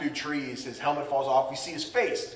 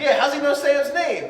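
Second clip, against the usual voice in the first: higher, louder sudden suspicion; mock realization; 12 semitones higher, 7 dB louder than usual. Misty noticing Oak knows Sam, higher surprised. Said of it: "Missy's like".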